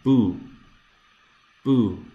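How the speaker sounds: "Boo" with no final T or M, vowel only; dropped final consonant. Shown as a demonstration. Teacher showing words without their last consonant. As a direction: stop dead on the vowel of "Boo". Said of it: "if you do not pronounce the last consonant".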